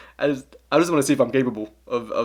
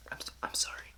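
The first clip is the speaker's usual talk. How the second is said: sheepish whisper after a rude joke; whispered apology; 14 dB quieter than usual. Real, not acted. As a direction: breathe the sorry without voice.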